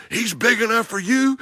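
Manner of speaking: mock eager hunter; excited hunter quote. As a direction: build up the hunter's thrill. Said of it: "the same thing that Chris said".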